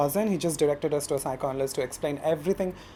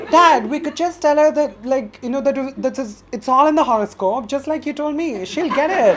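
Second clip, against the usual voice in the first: high, loud, eager appeal to Dad; excitedly selling a flimsy plan; animated pleading; 9 semitones higher, 8 dB louder than usual. Retold or imitated. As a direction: pitch it up, trying to persuade him. Acted pleading with dad in comedy story.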